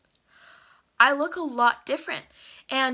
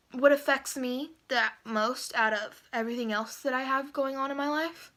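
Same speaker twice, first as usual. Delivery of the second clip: ordinary talk, nothing marked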